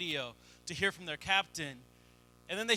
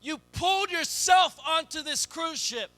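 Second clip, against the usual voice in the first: rising, louder preacher's push; preaching; 6 semitones higher, 4 dB louder than usual. Acted. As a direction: drive the point home to the congregation. Building sermon climax.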